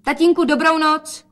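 high, loud farewell; calling goodbye. A narrator's reading. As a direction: toss a loud goodbye from the door. Daughter calls goodbye, leaving. (volá vysokým hlasem na rozloučenou)

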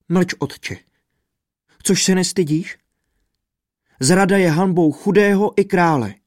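high, rising angry scolding of father; sharp rebuke. A narrator's reading. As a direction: scold her father in outrage. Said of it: "sama ho okřikla". (rozhořčené okřiknutí otce, vysoko)

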